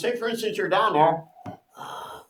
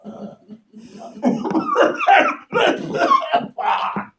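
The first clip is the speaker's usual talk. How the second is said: big high cackling laugh; loud burst of laughter; 10 dB louder than usual. Real, not acted. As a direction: let out a huge laugh at the memory. Laughing at the panic memory.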